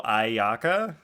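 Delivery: Ayaka guessed as eye-AH-ka; a tentative Ayaka, stressing the middle; unsure mispronunciation. Acted. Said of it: "see the word for the first time".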